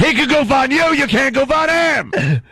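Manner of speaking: Mel Karmazin impression. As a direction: bark the rules up high. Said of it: "That sounded like Mel Karmazin".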